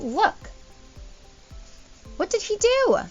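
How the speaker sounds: ordinary talk, nothing marked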